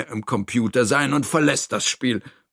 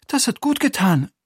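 high character voice, relieved; relieved Toni; 12 semitones higher than usual. Acted. Toni after all the screaming, high and relieved. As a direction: say it high, letting the upset go. Toni's figure voice.

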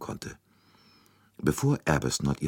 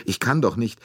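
higher, pleading outburst of distress; breaking down; 8 semitones higher, 4 dB louder than usual. A narrator's reading. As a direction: protest helplessly as you give way. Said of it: "plötzlich brach sie zusammen".

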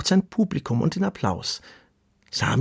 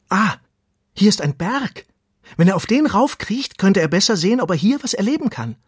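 high, louder burst of eager thought; excited discovery; 8 semitones higher, 5 dB louder than usual. A narrator's reading. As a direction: think aloud excitedly, pitched up. Worm spots a mountain.

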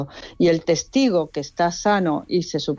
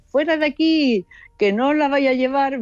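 high, protective mock yell; retold shouting; 7 semitones higher than usual. Nurse shielding her grandmother, retold shouting. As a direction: act out shouting them away, little added volume. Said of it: "empezó a gritarles a esa gente".

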